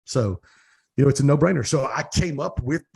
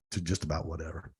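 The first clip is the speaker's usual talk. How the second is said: trailing echo; 7 semitones lower, 10 dB quieter than usual. Real, not acted. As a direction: echo "to just about whatever" much lower and softer, dying away. Echoes the phrase, fading out.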